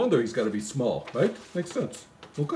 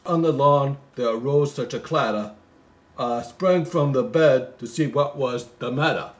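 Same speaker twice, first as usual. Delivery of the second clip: poem recital; 4 dB louder than usual. Acted. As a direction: read it like a bedtime Christmas poem. Reading the poem aloud.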